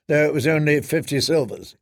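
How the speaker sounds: ordinary delivery, nothing marked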